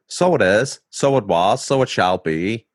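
lilting Irish catchphrase; Irish accent, each phrase set out like a chant. Shown as a demonstration. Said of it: "the key to unlocking my Irish accent is this".

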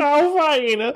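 very high whiny voice; Richard from The Undateables voice. Shown as a demonstration. Co-host echoing the king voice, very high whine. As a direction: whine it in the same squeaky voice. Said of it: "mostly Richard from the show".

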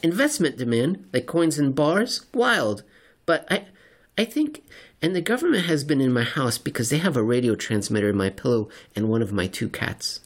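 light up then drift into conspiracy; excited crank. Acted. Seatmate character voiced.

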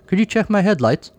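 high-pitched Australian woman's question; Aussie accent. A narrator's reading. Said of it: "said in an Aussie accent".

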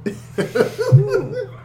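put-on very high, squeaky British accent; hammed-up high English accent; mock British accent. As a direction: pitch a silly British "governor" way up.

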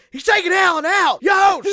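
high, loud yell that peaks on Yo; outraged parent shout. Retold or imitated. A parent in the stands yelling about his benched son. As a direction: scream it from the stands like an angry dad. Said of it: "he's just like".

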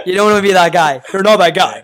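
laughing loudly through the words. Real, not acted. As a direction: belt it out while cracking up. Laughing, loud teasing repeat.

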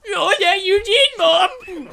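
high, loud whiny son; hammed-up shouted retort; Adam Sandler. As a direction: yell back like a bratty man-child.

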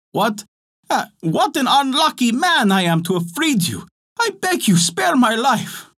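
a desperate little man crying for mercy; unhappy fisherman pleading. Acted. Fisherman begs the genie to spare his life, high and desperate. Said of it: "The fisherman was very unhappy".

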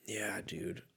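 a whispered aside; hushed private reaction. Real, not acted. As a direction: breathe it out almost silently. Reacting to the standings.

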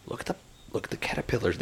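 put-on whispered, excited to a small child; hushed whisper. Dad whispering to his little daughter about caterpillars. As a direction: breathe it softly, pointing something out.

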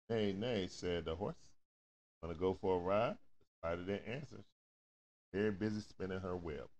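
soft, low storybook reading; reading to a child. Acted. Parent reading a picture book aloud to a child, softly. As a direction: read gently to the little one at bedtime.

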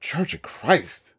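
slow, soft, stunned repeat; stunned disbelief. Retold or imitated. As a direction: echo it back in quiet shock. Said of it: "so you just imagine my eyes".

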